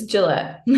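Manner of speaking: "Gillette" said as jih-LET; careful name pronunciation. Shown as a demonstration. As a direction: say "Gillette" with the stress on the second syllable. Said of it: "a lot of people pronounce it wrong".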